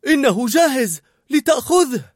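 very high, loud call; shouting. A narrator's reading. Cook to waiter as the dish is done, shouting. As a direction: shout that it is ready. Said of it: "صاحت كوليت".

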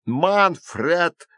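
high yell of a name; high, drawn-out holler. A narrator's reading. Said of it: "заорала Обливия".

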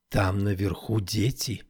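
slow, hushed whisper; soft, drawn-out murmur. A narrator's reading. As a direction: whisper it slowly, keeping hidden. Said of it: "прошептала она".

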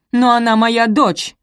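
loud, high, slow outcry; indignant protest. A narrator's reading. Mother objecting to the judge about her daughter, loudly protesting. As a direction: stretch out an appalled objection.

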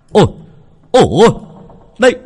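high, loud excited shout from the water. A narrator's reading. Old dike keeper surfacing and shouting that he found the body, high loud yell. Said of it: "đoạn hô to".

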